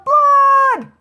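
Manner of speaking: very high, loud drawn-out shout; Loretta's yell. A narrator's reading. Loretta points at her brother for police, high loud yell. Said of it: "Loretta pointed at her brother and yelled".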